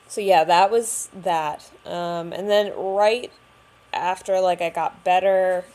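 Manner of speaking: ordinary delivery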